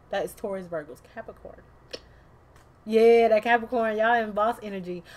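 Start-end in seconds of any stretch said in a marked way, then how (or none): 2.9-5.0 hyped. Real, not acted.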